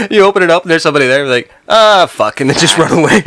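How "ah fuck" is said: loud, high startled yelp; hammed-up fright; mock panic. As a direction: play startled and ready to bolt. Acts finding someone in the closet.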